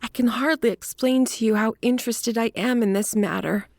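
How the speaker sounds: high voice, earnestly interested; eager little woman. A narrator's reading. Little woman telling detective of her interest, high and eager. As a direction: plead interest in a high feminine voice. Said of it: "cried the little woman".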